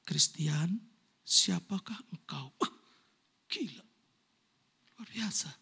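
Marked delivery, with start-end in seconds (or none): 0.1-2.5 lower, slow, solemn question; voice of God. Retold or imitated. Pastor voicing God asking him who he is, low and slow. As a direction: let God's question land heavy and slow. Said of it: "Tuhan bilang gini".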